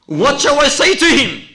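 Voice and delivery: loud, very high desperate cry. Acted. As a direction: shout the question at full voice. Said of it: "he cries out".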